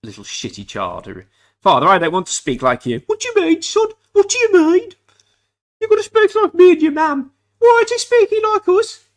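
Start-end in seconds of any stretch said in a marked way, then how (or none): 1.6-3.0 louder, higher mock protest to father; posh-talking child. Snobbish child refusing father's speech, mock protest. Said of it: "there's no reason why I don't speak like that". 3.1-9.0 very high, loud, hammed-up Dudley accent; Dudley-accented father. Father scolding son for speaking differently, shrieked mock Dudley accent. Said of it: "Where they all spoke like this".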